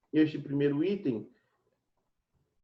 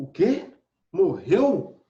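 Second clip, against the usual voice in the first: "O quê? Morreu?" louder and raised in shock; shocked surprise; 4 semitones higher, 6 dB louder, much slower than usual. Shown as a demonstration. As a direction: gasp it out, astonished. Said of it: "observa como a entonação que foi dada a isso, o espanto". (espanto, mais alto e agudo)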